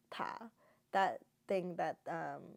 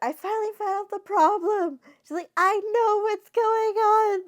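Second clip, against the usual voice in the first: excited discovery; 13 semitones higher, 11 dB louder than usual. Retold or imitated. Miao Miao thinks she found the problem, high, loud and triumphant. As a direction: proudly declare you solved it. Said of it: "And then she's like".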